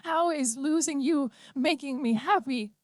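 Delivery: high, despairing cry; mother's high wail; anguished scream. A narrator's reading. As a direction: keep screaming at her daughter. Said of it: "Marilyn screamed suddenly".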